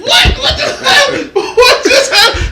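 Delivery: high, laughing disbelief at what just happened; retelling his brother's tears with a high laughing what; shocked disbelief. Retold or imitated. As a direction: squeal it out in amazed laughter.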